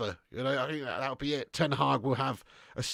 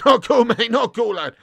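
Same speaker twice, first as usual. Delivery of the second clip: laughing through it, louder; 6 semitones higher, 8 dB louder than usual. Real, not acted. Laughing rebuke to Chopper for his joke. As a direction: scold him while cracking up. Laughing at a cheeky super chat.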